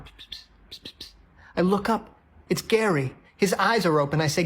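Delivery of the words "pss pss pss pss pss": short, very soft voiceless psst bursts; imitated hissing sound. Diner hears a noise from Gary, soft imitated pss sounds. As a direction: make little repeated "pss" noises, very softly. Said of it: "then I hear this sound".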